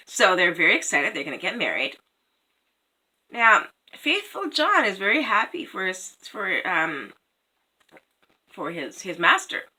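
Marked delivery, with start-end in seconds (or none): none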